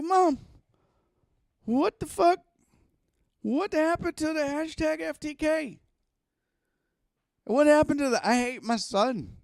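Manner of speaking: high, loud, exasperated yelling; shrill-pitched loud mock tirade at mom; angry at mom. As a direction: retell scolding mom, outraged. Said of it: "I was so pissed".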